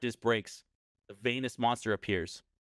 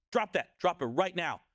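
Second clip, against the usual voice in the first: urgent command; 6 semitones higher than usual. Retold or imitated. Dad in story orders son.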